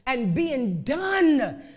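drawn out, weighty and slow; slow emphatic reading. Shown as a demonstration. Preacher reading scripture slowly for the church. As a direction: lean on done, slowly. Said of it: "being done now with it".

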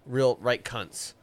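slow, deliberate, every word weighted. Real, not acted. Calling the castle owners names, slow and deliberate. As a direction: drag it out as a bold verdict. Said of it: "I'll say it".

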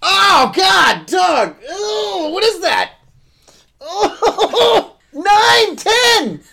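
high, loud panicked shrieking; panicked screams with laughter; phobic panic. Real, not acted. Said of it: "Do you know how stressed I am about this".

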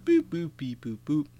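high electronic beeps, sung out; toy robot voice. Retold or imitated. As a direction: chirp like a beeping toy. Imitating the Simon toy's beeps.

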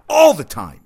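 loud and fed up; exasperated loud burst; exasperated outburst. Real, not acted. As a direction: blurt it out, sick of it. Ranting about injury-prone player.

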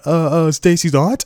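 high, loud questioning wrong guess; unsure contestant. Acted. Contestant can't get Stacy's mom right, acting unsure and high. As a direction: act out a hopeful, clueless stab at it. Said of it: "He's like".

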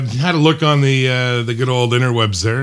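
usual speaking voice

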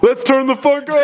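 high imitation of a stage hype yell; retold hype shout. Host re-enacting the guest's banter before a set, high hype yell. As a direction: mimic a performer firing up the crowd. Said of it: "you were just like".

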